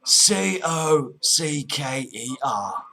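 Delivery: letters spelt out slowly, one by one; slow spelling. Pretend. Cockney caller spelling surname for the agent, slow.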